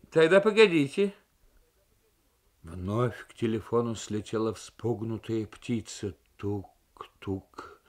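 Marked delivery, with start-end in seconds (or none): 0.1-1.1 a hint of a high crowing voice; lightly voiced rooster-like official. Maltsen gives in on the phone, lightly crowing voice. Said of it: "петушиный голос Мальцена". 6.4-7.8 whispered tap-tap; tapping sound. Acted. Bird pecking the phone membrane, whispered tapping.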